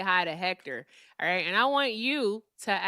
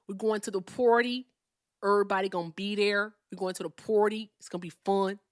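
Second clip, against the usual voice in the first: put-on Compton talk, vowels exaggerated; Compton accent. Shown as a demonstration. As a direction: channel a Compton sitcom teen. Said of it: "here's my Compton accent".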